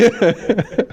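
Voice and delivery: short high laugh, falling away; high burst of laughter. Real, not acted. Electrician laughs after telling about the old lady, amused. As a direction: laugh at the awkward memory. Laughs at own embarrassing story.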